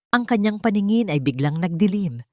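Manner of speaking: ordinary delivery, nothing marked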